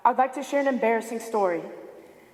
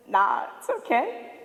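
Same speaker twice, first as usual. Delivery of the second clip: feigned nonchalance; 2 semitones lower than usual. Retold or imitated. Teen excusing herself from the ride to friends, feigned nonchalance. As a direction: play it casual to hide mild panic. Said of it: "attempting to hide the panic attack".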